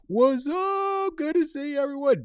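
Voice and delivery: thrilled to see viewers arrive; excited greeting. Real, not acted. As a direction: greet everyone with a burst of energy.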